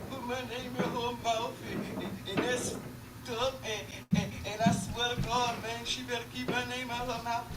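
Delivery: lightly whiny imitation of a crying man, slowed; hammed-up sniffly complaint, half-hearted; mock crying rapper. Mother mocking the young rapper's crying video, whiny mock sob. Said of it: "crying yesterday about".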